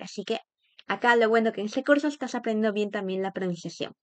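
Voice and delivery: ordinary talk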